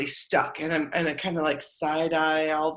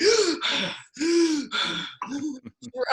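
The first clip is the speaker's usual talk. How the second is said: sharp gasp, higher pitched; big gasp; 6 semitones higher than usual. Retold or imitated. Back at the altar after the sprint, a high gasp for air. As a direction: suck in air like you're out of breath.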